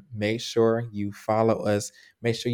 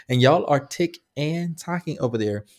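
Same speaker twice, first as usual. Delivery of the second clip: ordinary talk, nothing marked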